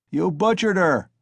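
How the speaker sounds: high, loud accusing cry; outraged shout. A narrator's reading. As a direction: accuse him from the crowd. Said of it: "someone shouted in the crowd".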